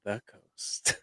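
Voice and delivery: whispered station ident; soft, whispery radio tag. Acted. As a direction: breathe it out like a smooth-jazz DJ. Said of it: "With your radio voice?".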